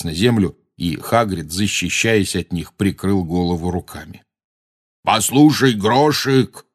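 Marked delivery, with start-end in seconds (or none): 5.0-6.6 raised, louder call. A narrator's reading. Hagrid calling up to his giant Grawp, shouting. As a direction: shout up to get the giant's attention. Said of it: "крикнул Хагрид".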